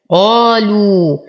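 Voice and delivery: slow قالوا with drawn-out final uu; long madd. Shown as a demonstration. Teacher reciting Warsh style, قالوا with long madd. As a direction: stretch the end of قالوا. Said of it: "يمد بالإشباع لورش بمقدار ست حركات".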